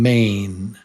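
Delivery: slow, drawn-out "mane"; slow teaching contrast; slow model word. Shown as a demonstration. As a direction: stretch it for learners to copy. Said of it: "say them with me".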